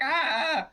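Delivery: loud, high cries of "ah"; acted cry. Game master as Fabler stumbling out of the kitchen, crying out. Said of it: "He's like".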